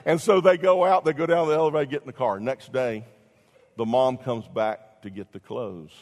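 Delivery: ordinary talk, nothing marked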